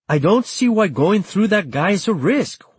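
high-pitched protesting argument; stubborn, agitated objection; insistent. A narrator's reading. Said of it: "Joaquin insisted".

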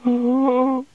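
high thin squeak of alarm. A narrator's reading. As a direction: let a startled squeak slip out. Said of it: "squeaked the senior wrangler".

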